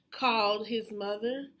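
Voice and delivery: plain reading voice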